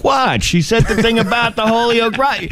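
high-pitched indignant explaining; mock incredulity. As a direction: retell it in a high, disbelieving voice. Retelling his own joke explanation.

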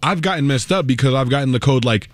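usual speaking voice

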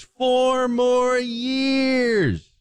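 high, hugely stretched-out rally cry; shrill-pitched mock chant, very slow; mock screaming. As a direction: imitate someone screaming, dragging out every word. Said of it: "screaming at the top of her lungs".

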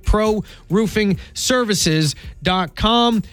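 "Pro roofing services dot com" very slow, word by word; slowly spelled-out web address. Acted. Host repeats the sponsor's website for listeners, slow and spaced out.

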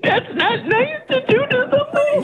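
real tears, high and wavering; hurt and crying, reproaching the host. Real, not acted. Caller crying at the prank host, hurt. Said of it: "Don't cry".

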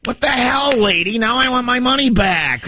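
high-pitched mock outrage, slowing at the ends; indignant stranger. Stranger who paid her way in complains he is her food runner, high mock outrage. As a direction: play the put-upon stranger, voice pushed up high.

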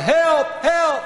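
very high, loud yelps; frantic comic cries for help; hollering for help. Acted. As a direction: holler for rescue while running past. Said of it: "He hollered".

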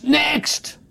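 high, sharp mock call of "Next!"; retold shout. Teller calling the next customer, mock shout. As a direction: bark "Next!" across the bank hall like an impatient teller. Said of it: "somebody on the right screams".

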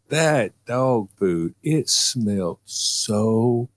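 very slow, dragged out in disgust. Real, not acted. Telling friends about the dog food stench, very slow. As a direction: drag "that dog food" out as if it still stinks. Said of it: "It was a horrible smell".

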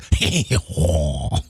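low, drawn-out French "hee-haw" chuckle; mock French laugh. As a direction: put on a stagey French chortle. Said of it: "I should be like".

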